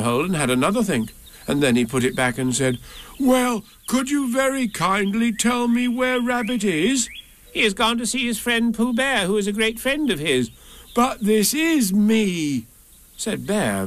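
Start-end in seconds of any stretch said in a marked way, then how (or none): 3.2-7.1 high, slow, courteous bear voice; Pooh. A narrator's reading. Pooh politely asks for Rabbit. 7.5-10.5 high put-on creature voice; Rabbit. A narrator's reading. Rabbit pretends to be someone else. 10.9-12.7 high, drawn-out astonishment; very surprised. A narrator's reading. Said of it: "very much surprised".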